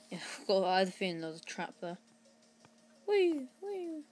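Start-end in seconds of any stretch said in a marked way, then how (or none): 3.1-4.0 high squeal of fun; playful whee. Pretend. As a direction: squeal like on a slide. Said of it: "playful jump cry".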